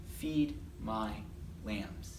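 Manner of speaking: low, soft, word by word; gentle command with pauses between words; solemn memory verse. Acted. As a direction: recite it softly, sinking at the end. Jesus's memory verse, solemnly recited.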